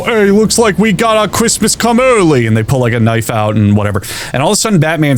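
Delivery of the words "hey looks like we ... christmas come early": high-pitched goofy thug voice; Joker thug. Acted. Thugs mugging a newcomer to Gotham, high henchman voice. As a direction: put on a cartoon henchman, pitched up. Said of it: "A group of Joker thugs come out".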